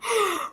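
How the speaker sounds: sharp high gasp of shock. Real, not acted. Hearing a friend's scandalous line, a shocked gasp. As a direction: gasp like you just heard something scandalous.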